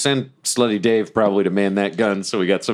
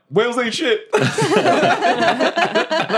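laughing; much slower than usual. Retold or imitated. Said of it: "I think back and I'm like".

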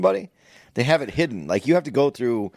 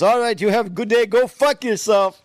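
high, loud imitation of an Indian caller; mock Indian scammer signing off angrily; Indian accent; 10 semitones higher, 5 dB louder than usual. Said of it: "and he had the accent".

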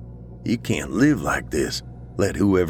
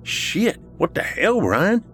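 very high, jolted exclamation; startled; slower than usual. Acted. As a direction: react as if someone made you jump. Boom makes Dave jump, spill drink.

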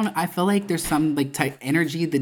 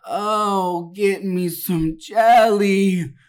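high, slow, pleading whine; strung-out woman; 6 semitones higher, 4 dB louder, much slower than usual. Retold or imitated. Woman in the booth begging for menu help, high and whiny. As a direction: beg the waiter for help like a tweaker. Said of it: "And she's like".

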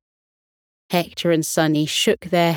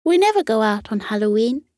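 quick shy admission; 3 semitones higher, faster than usual. Acted. Cat explains shyly.

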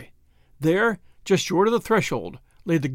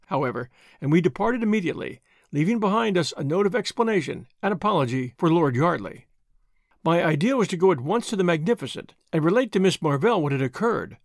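ordinary delivery, nothing marked

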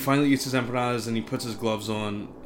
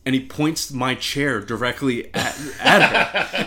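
normal talking voice

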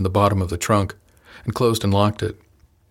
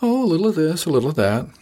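airy and offhand, the first "Oh" leaping up high; breezy, evasive shrug in the voice; very light; 8 semitones higher, faster than usual. A narrator's reading. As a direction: toss it off lightly, giving nothing away. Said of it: "I answered very lightly".

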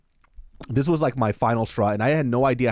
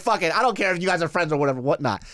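higher, rushed throw-it-away delivery; reckless resolve; 6 semitones higher, faster than usual. Retold or imitated. Comic retells giving in to temptation, reckless. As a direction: throw caution away, pitched up and quick. Acting out his reckless decision.

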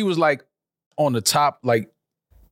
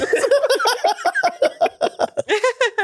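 high, sustained laughter; long high cackle of laughter. Real, not acted. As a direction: lose it completely. Big laugh at Syracuse.

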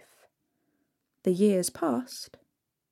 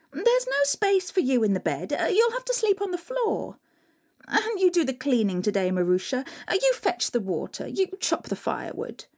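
bossy stepsisters; 6 semitones higher than usual. Acted. Stepsisters bossing Marusha.

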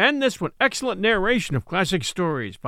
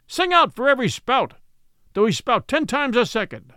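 captain Ahab haranguing his mates and lookouts, fierce and higher; raging captain Ahab; 3 semitones higher than usual. Acted. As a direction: rail at them like a driven captain. Ahab's raging speech to crew.

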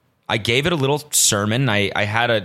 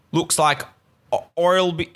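halting, higher-pitched Australian attempt; botched Australian accent; 7 semitones higher than usual. Retrying an Australian friend's text, accent slipping away. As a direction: try the Australian accent and stumble. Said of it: "I'm really not getting this Australian accent".

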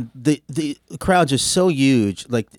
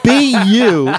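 high, loud mock pep talk; mock earnest advice; 9 semitones higher, 8 dB louder than usual. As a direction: pump him up like a cheerleader. Brother's advice, acted quote.

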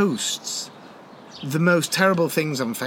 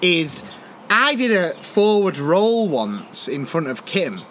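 ordinary delivery

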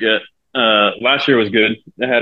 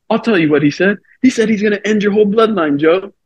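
retold eager tattling; 10 semitones higher than usual. Acts out teammate eagerly tattling.

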